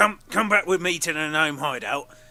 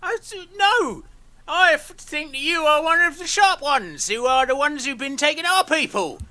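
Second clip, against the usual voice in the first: flustered gnome; 7 semitones higher than usual. Acted. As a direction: sputter then push back. Gnome arguing back with kobolds.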